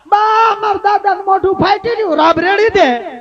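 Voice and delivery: mock-panicked grandchild shout; child shouting.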